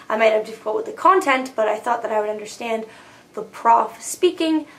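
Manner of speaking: ordinary talk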